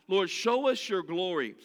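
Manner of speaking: ordinary delivery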